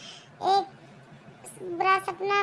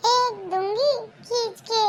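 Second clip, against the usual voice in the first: playful slow threat to slap; mother jokingly threatening to slap her son; mock threat; 3 semitones higher, slower than usual. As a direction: pretend to scold the cheeky son.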